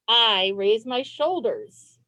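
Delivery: normal talking voice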